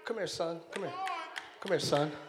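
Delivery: God voiced low and relaxed; God calling the Son. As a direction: beckon them over, casual and fond. Preacher voices God calling the Trinity.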